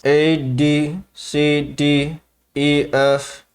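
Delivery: ventriloquist alphabet, lips still; talking through teeth. Shown as a demonstration. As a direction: recite letters without moving your lips. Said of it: "F's are hard too".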